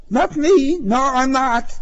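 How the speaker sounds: very high, loud protest; squeaky flustered innocence; panicked denial. A narrator's reading. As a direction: deny it frantically. Driver denies the accusation.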